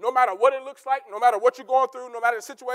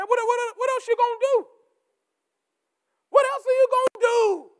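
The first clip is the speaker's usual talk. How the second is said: high rhetorical cry; 10 semitones higher, 3 dB louder than usual. Acted.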